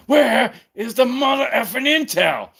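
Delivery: high, loud put-on tough-guy voice; Samuel L. Jackson as Miss Pauling. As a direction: bark it like a badass action star playing a prim assistant. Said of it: "I'd rather see Samuel L. Jackson as, like, Miss Pauling".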